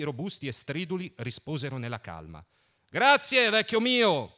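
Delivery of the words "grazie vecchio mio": high, loud shout into the distance; loud called-out thanks; shouting loudly. A narrator's reading. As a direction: call out thanks from far away. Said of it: "gridò forte".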